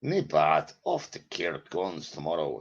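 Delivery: slow, badly faked Scottish accent; amateur Scots impersonation, drawn out. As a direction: have a clumsy go at a Scottish accent. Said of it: "sorry, it's a terrible accent".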